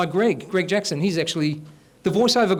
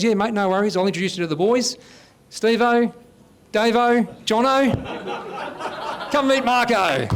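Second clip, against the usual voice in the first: higher, bright Aussie tradie calling his crew over; cheerful site boss; 4 semitones higher, slower than usual. Retold or imitated. Site supervisor introduces the new apprentice, higher and calling out. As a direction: call the boys over with a grin. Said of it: "He goes".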